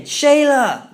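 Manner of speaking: loud, high call of Shayla!; girl screaming for her friend in the crumbling castle; screaming a name. A narrator's reading. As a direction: scream for your lost friend.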